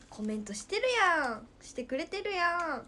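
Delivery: 弾んだ声で気づく; コメントを見つけて大喜び; 嬉しい驚き. Real, not acted. コメントに気づいて喜ぶ. (コメントに気づいて嬉しそうに)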